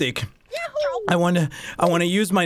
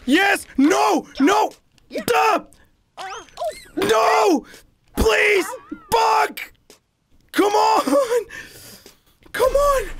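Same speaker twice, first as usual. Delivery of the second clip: frantic shouting; 14 semitones higher, much slower than usual. Real, not acted. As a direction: scream at the game. Frantic game moment.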